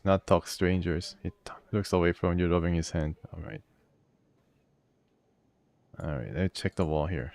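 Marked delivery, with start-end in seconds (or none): none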